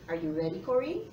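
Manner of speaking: usual speaking voice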